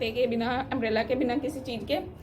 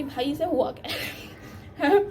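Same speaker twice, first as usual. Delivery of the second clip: bewildered teacher; slower than usual. Retold or imitated. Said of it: "ma'am कह रही".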